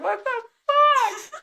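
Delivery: high, slow, loud wail; horrified outburst. Retold or imitated. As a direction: wail it in stretched-out disbelief. Retelling wasp hanging off foot.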